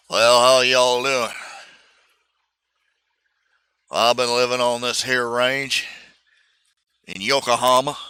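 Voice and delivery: slow put-on Texas drawl; easy cowboy Texas drawl, drawn out. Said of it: "with a Texas drawl".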